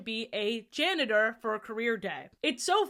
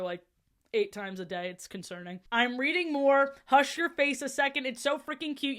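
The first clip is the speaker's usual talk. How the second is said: plain reading voice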